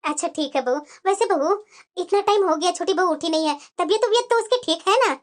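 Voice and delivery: put-on an old mother-in-law nodding along; elderly mother-in-law.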